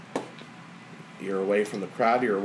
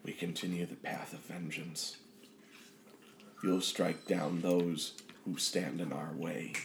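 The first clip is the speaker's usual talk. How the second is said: vengeful inner presence; 4 semitones lower, 11 dB quieter than usual. Acted. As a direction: softly urge them toward revenge, like a dark tempter.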